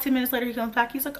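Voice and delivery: ordinary talk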